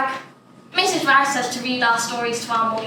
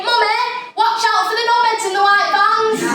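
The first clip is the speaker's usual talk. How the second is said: cheeky call-out; 10 semitones higher, 5 dB louder than usual. Retold or imitated. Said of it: "I say".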